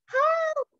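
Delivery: very high storybook cry; witch's cry for help. A narrator's reading. Witch chased by a dragon, crying for help. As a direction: cry out high, without much volume. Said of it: "cried the witch".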